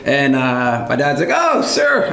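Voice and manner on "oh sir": high-pitched mock call to a stranger; pitched way up to get attention. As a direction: imitate dad hailing someone, voice jumping up. Dad calls out to a stranger.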